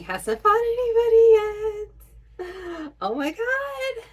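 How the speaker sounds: high, loud fan squeal; squealing with fangirl excitement; excited squeal. Real, not acted. Fan reacting to anime fight.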